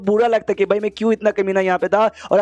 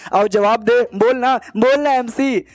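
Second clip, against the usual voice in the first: higher, drawn-out mock yell; acted angry demand; 4 semitones higher, slower than usual. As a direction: demand an answer, pitch rising on the repeats. Acted demand for an answer, cursing.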